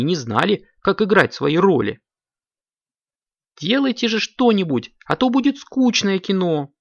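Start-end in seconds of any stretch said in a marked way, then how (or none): 3.6-6.7 high, exasperated urging; angry, strict. A narrator's reading. Strict director to confused actors, high cross scolding. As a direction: scold them in a raised pitch. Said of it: "Снова рассердился строгий режиссёр Коржик".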